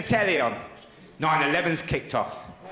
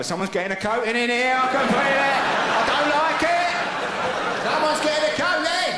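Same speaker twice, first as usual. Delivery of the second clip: rising to a high, fast alarmed shout; men in the pub sense a row brewing, alarmed shout; mock alarmed men; 8 semitones higher than usual. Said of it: "Men were turning to each other".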